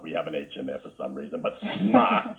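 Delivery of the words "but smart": smart with a clear R, squeaked much higher and louder; the R in smart sounded out, pitched way up; proper smart. Shown as a demonstration. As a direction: pronounce the R in smart, high and loud. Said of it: "We don't pronounce the R".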